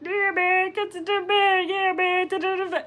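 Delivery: high, steady cartoon creature drone; Meatwad. Streamer shows chat how he sounds, high cartoon impression. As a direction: do a squeaky cartoon meatball. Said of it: "Meatwad from Aqua Teen Hunger Force sound like that".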